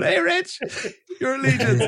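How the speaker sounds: very high, teasing imitation of a hello; mock eager greeting. Teasing a friend who greeted the wrong man, high mock greeting.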